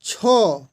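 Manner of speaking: छो said slowly with a strong puff of breath after the chh; aspirated demo. Shown as a demonstration. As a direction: make the breath in छो clearly heard. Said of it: "इसका आप बोल करके भी इसको छांट सकते हैं". (छो को ज़ोर की साँस के साथ बोलें)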